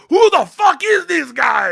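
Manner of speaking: high, loud, drawn-out shout; hysterical delight. Retold or imitated. Amused Russians about clowning student, hysterical shouted question. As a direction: holler it as if dying of laughter. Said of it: "they're dying la".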